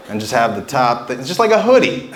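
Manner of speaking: ordinary talk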